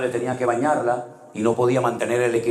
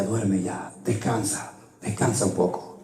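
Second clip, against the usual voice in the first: soothing; 3 semitones lower, 4 dB quieter, slower than usual. Retold or imitated. Wife soothing him at night.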